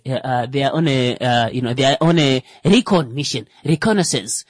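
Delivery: ordinary talk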